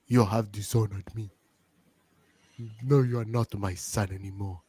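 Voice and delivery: low, soft, grave put-on accent; Kofi's mom. Mother looming in the foreground, low mock-solemn accent. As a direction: intone it like a solemn disowning mother. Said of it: "It's just Kofi's mom in front".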